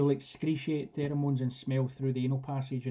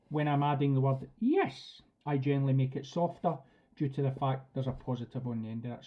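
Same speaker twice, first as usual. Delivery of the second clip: ordinary talk, nothing marked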